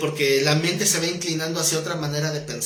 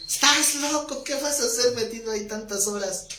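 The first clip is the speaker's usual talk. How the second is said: higher, mocking disbelief; incredulous friend; 8 semitones higher than usual. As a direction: scoff at the idea of church. Amigo incrédulo ante la iglesia. (amigo incrédulo y burlón)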